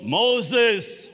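loud ringing call of a name; God calling out. Acted. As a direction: call the name out across the desert. God calling Moses in wilderness.

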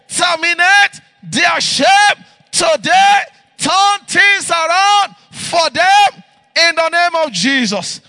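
loud, fervent shouted prayer; fervent prayer preaching. Acted. Pastor praying over the families, fervent shouted prayer. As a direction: pray out over the congregation with rising fervour.

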